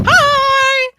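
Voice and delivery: loud, high call of hello; high-pitched greeting. Acted. Character waving a knife at the newcomers, calling out brightly.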